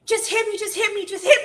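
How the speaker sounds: high, taunting neighbour voice; mock upstairs woman. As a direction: mimic her goading her man.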